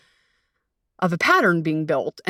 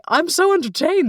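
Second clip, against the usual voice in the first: a much higher, gushing take on her past self; mock delight; 9 semitones higher than usual. Telling friends how dating strangers thrilled her, in high mock delight. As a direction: gush like a kid at a show.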